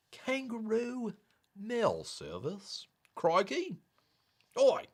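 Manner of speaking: high, drawn-out Aussie aside; mock Australian accent. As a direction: say it slowly as a cartoon Australian. Repeats phrase in mock Aussie accent.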